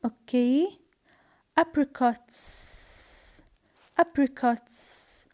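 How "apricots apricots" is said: "Apricots" ending in a long voiceless hiss; careful, drawn-out model of "Apricots", no z at the end; final S as s. Shown as a demonstration. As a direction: hold the sss at the end of "Apricots". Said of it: "I say is".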